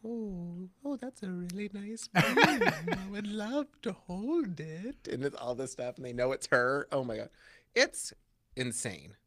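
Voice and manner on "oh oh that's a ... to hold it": put-on higher, slow sugary old lady; fake-nice old lady. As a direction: sweet-talk like a fake nice granny. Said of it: "trying to be all like".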